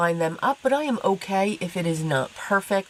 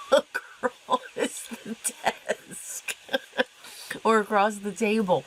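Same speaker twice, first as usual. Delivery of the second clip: laughing; 5 semitones higher, 3 dB quieter than usual. Real, not acted. Laughing while describing fast crafting.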